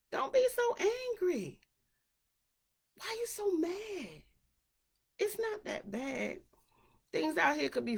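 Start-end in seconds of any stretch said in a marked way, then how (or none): 0.1-6.4 high coaxing plea; mock pleading. To an angry friend, high coaxing plea. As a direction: coax them out of it, pitched up.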